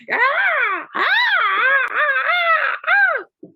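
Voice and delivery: very high, loud repeated screeches; cats' screeching. Acted. Storyteller screams as all the cats flee, high screeches. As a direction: screech like a pack of panicked cats.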